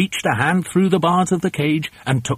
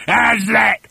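high, eager shout; excited shout; 9 semitones higher, 4 dB louder than usual. Acted. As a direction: shout it proudly to your partner in crime. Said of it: "he shouted".

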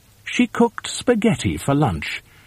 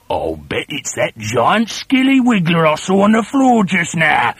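sly husband; 6 semitones higher than usual. A narrator's reading. Husband frightens his wife, sly old man voice. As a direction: tease her with mock concern. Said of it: "Mister Twit said".

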